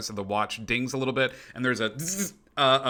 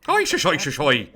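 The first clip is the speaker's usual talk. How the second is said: higher, sharp hushing at a misbehaving watch; louder mock scolding shush; shushing the gadget; 3 semitones higher, 5 dB louder than usual.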